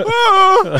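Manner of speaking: high, loud mock scream; imitated scream. Mother hit by the voice, imitated scream. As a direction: wail out a mock cry.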